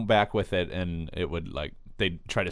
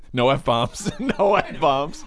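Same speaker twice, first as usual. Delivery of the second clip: laughing through the words, rising louder; laughing warning; 5 semitones higher, 4 dB louder, much slower than usual. Real, not acted. Co-host warning partner off swearing, laughing. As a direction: warn through real laughter.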